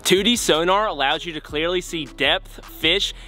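polished sponsor plug; sponsor ad read. Acted. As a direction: sell it to the viewers with lift on key words.

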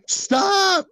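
sudden high yell; shouting stop. Real, not acted. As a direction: cry out to make him stop. Yelling at friend's action.

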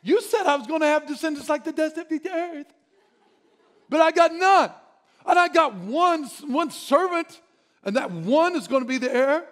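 high, loud exasperated complaint; exasperated Abram. Acted. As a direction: plead your case in frustration. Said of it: "He's saying".

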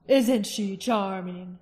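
king's voice. A narrator's reading. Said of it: "asked Galbatorix".

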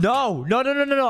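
high, rapid-fire noes; frantic denial. Acted. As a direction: panic and deny it, pitch climbing. Man frantically denies to wife.

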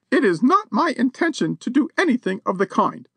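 high, haughty lady's voice; a high society lady standing on her dignity. A narrator's reading. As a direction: look down your nose at him. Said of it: "announced the lady haughtily".

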